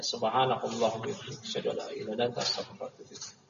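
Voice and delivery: quick quiet dua to end the class; closing prayer. Real, not acted. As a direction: rattle off the closing dua, dropping away to breath.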